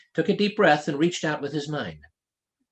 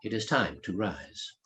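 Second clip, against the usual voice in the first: quiet mental command; 5 semitones lower, 6 dB quieter, slower than usual. A narrator's reading. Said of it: "would also sense the thought".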